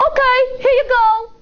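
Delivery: high, fast, breezy surrender; giving in. Acted. Bully suddenly handing the apple back, fast and light.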